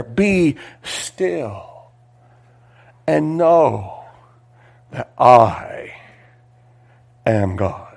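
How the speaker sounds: ringing reading that falls to a hush at the end; build, then drop to a hushed close; scripture reading. Acted. Preacher finishing the psalm for the congregation, then hushing.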